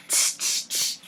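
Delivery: whispered tss tss; quiet mock tsk; monkey tsking. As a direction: retell the cheeky tsk softly. Monkeys retold by reader.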